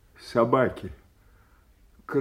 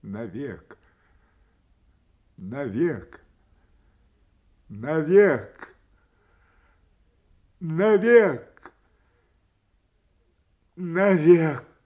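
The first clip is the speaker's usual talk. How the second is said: building grief; 4 semitones higher, 6 dB louder, slower than usual. Acted. Father over his dead daughter, grief building to a cry. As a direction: let each word climb into a wail.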